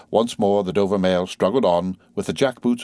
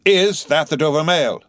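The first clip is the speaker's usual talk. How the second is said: high, loud distant call; calling from a distance; 9 semitones higher, 4 dB louder than usual. Acted. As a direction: call out again from the mist. Rider calls again from mist.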